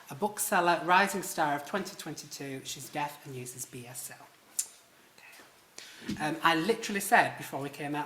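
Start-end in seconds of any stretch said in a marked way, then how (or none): none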